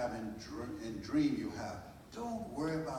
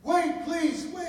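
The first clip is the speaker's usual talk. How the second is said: high, loud desperate scream of wait; 12 dB louder, much slower than usual. Retold or imitated. Birth mother screaming after the car, desperate. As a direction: shout after the leaving car, begging. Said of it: "he heard a scream".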